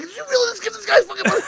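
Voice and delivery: high, fast, laughing through the words; laughing disbelief. Retold or imitated. Man retelling his friend's scam, laughing in disbelief. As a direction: crack up while asking it.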